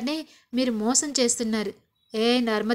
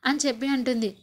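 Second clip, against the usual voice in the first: ordinary talk, nothing marked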